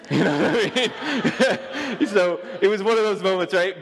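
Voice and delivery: talking through laughter, high and loud; high-pitched, laughing words. Real, not acted. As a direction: crack up at your own story. Laughing at his own story.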